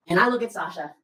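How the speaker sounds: ordinary delivery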